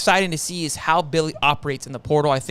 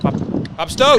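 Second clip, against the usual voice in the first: calling out; 7 semitones higher, 4 dB louder than usual. Real, not acted. Calling out to co-host on stream.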